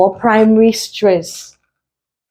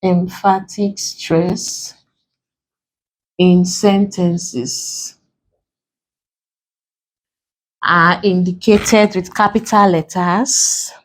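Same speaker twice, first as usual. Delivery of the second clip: slow phrases split by long pauses; dictation; slower than usual. Shown as a demonstration. Teacher dictating how emphatic stress is indicated, slow dictation. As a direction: dictate slowly, leaving time to write.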